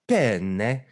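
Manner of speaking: hammed-up fake Italian food order; mock over-pronounced Italian accent; obnoxious Italian accent. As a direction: show off a bad Italian accent.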